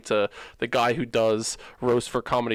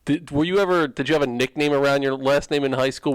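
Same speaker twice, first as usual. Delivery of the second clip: ordinary talk, nothing marked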